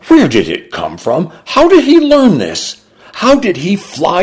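high, far-pitched astonished question; stunned disbelief. A narrator's reading. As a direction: let the voice leap up in bewilderment. Mother stunned by son's crime.

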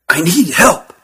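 understated urgency; urgent plea. A narrator's reading. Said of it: "Urgency was in Jeelan's voice".

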